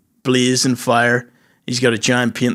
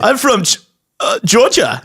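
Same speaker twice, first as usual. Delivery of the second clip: German uncle voice; 7 semitones higher than usual. Pretend. Voicing Uncle Hitler.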